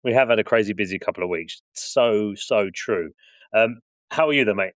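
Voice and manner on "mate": ordinary delivery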